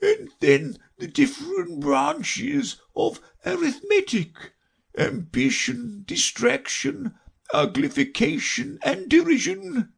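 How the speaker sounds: high, drawn-out creature voice; Mock Turtle. A narrator's reading. Mock Turtle lists his lessons, slow high wail. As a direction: intone the subjects dolefully.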